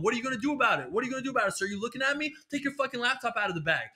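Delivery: hammed-up tough guard threatening; yelling TSA agent. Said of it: "yell at you".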